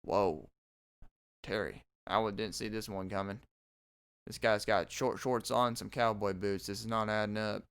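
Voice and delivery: low, hushed, baffled mumble. Pretend. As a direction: mutter it low in disbelief. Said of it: "they're just like".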